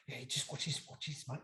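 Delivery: low and soft, scheming; conspiratorial hush. Retold or imitated. Answering Rhino at the bar, hushed and sly. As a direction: keep it hushed so no one hears. Retold conspiratorial reply to mate.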